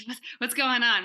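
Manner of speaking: bewildered, half laughing; laughing confusion. Retold or imitated. Guest confused by a stray screen share, laughing. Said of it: "I was like".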